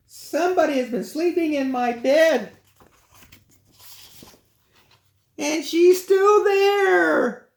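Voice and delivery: put-on very high baby bear, ending in a slow loud shout; tiny bear shrieking the alarm. Baby bear finds Goldilocks.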